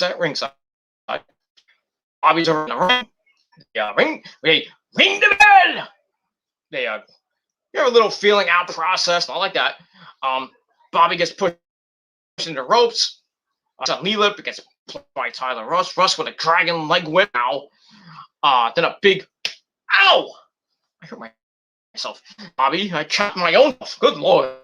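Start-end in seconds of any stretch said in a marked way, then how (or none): 4.4-5.9 high sustained bell call. Real, not acted. Imitating the bell. 20.0-20.3 high yelp of pain; sharp pained ow. Real, not acted. Hit his own chest, high yelp of pain. Chopped himself.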